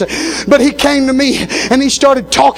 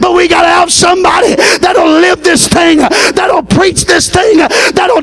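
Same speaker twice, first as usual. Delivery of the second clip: rising preaching climax; 6 semitones higher than usual. Acted. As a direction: drive the final call to the church. Climax of the sermon.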